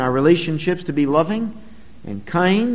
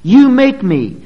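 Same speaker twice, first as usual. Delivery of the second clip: higher, louder blaming jab; blaming quote; 7 semitones higher, 9 dB louder, slower than usual. Retold or imitated. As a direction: point the finger, raised and loud. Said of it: "an attempt to pin the blame on somebody else".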